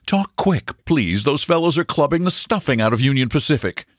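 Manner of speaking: a busy man blurting out a marriage proposal in a hurry; hurried, flustered broker. Acted. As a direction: broker rushing his proposal to the stenographer, fast and high. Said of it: "he began hurriedly".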